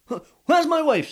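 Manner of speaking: put-on high, loud bewildered old fisherman; puzzled, searching for his missing wife; baffled husband.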